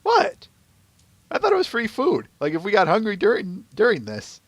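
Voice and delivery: high protesting "what?", easing back down; defensive protest. Acted. Dash defends eating the carrot, high and defensive.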